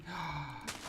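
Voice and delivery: soft, drawn-in gasp; wistful gasp. Real, not acted. Gamer gushing about Italy, soft admiring gasp.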